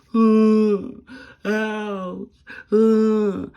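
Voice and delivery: high, loud mock moans with an ouch; imitating moaning. Mocking the woman in the next bed, exaggerated moans. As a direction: ham up a patient's pained moaning. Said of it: "moaning and groaning the whole damn time".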